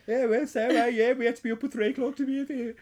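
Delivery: put-on high, quick northern English accent; chatty northern British accent, fast. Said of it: "this northern British accent".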